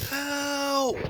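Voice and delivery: high, thin cry from inside the fridge; small mouse calling for help. A narrator's reading. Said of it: "he called".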